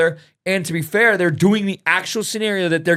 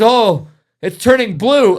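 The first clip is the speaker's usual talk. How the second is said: slow, louder, higher alarmed cry; mock alarm; 7 semitones higher, 7 dB louder, slower than usual. Student noticing the VR baby in distress, mock alarm. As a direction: draw it out in mock panic. Kid panicking over VR baby.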